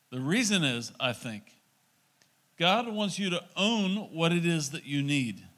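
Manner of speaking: ordinary talk, nothing marked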